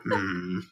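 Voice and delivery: low mock grumble. Friend imitates Batman just grumbling in reply, low grumble. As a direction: grumble wordlessly, low in pitch.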